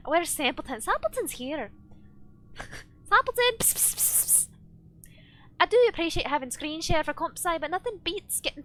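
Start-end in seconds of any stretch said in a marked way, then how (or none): 3.1-4.5 calling out. Real, not acted. Streamer calling Sampleton over, calling out. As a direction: call the name brightly, then coax with psst.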